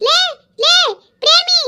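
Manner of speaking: high shout after Premi; calling out. Acted. Neighbour shouting after Premi to stop, high call.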